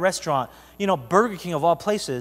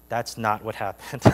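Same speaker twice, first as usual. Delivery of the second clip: ordinary delivery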